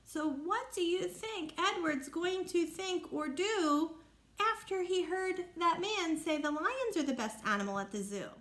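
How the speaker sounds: ordinary talk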